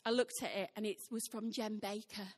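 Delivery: ordinary delivery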